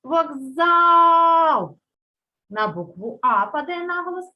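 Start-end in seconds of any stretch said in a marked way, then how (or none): 0.0-1.8 вокзал called out, second syllable long and loud; вокзААААл, with a held, shouted stressed vowel; stress on ЗАЛ. Shown as a demonstration. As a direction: stretch the а in вокзал high and loud, as if calling. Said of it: "Визначаємо наголос в слові вокзал".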